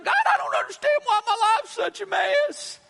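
hammed-up, bewildered cry to heaven; exasperated plea to God. Preacher mimicking people asking God why, exasperated plea. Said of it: "and then we ask God".

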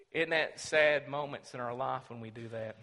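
usual speaking voice

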